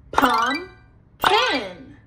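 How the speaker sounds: "Pumpkin" split into two beats with a gap; syllable by syllable. Shown as a demonstration. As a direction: break "Pumpkin" after the first syllable, each part drawn out. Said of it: "clap the syllables".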